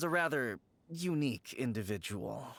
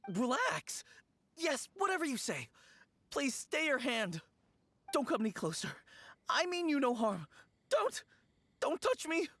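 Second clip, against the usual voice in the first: high, panicky begging; frightened pleading; 11 semitones higher than usual. Acted. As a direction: plead in terror, backing away. Frightened man begs to be spared.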